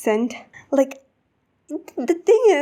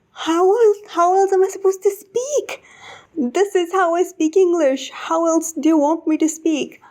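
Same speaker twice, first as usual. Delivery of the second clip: higher-pitched, incredulous protest; exasperated retort; 6 semitones higher than usual. Retold or imitated. Job applicant objecting to the HR lady's accent request, exasperated. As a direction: throw the question back in disbelief.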